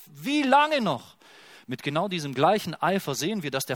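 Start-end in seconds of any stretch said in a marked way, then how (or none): 0.1-1.2 high, loud, slow anguished cry. Acted. The Son cries out to the Father, anguished loud plea. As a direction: plead desperately to the Father. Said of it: "Der Sohn schreit".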